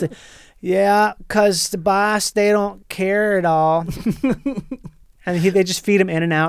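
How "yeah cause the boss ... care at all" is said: put-on slow Wisconsin welder accent, easygoing; a lightly voiced out-of-work welder from Wisconsin. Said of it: "this character".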